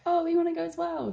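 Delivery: lightly voiced high keen friends; mock eager. Quoting keen group.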